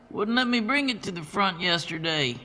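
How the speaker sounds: high, grumbling big warehouse man; Bert complaining. A narrator's reading. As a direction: grumble like the jolly forklift driver. Bert keeps talking.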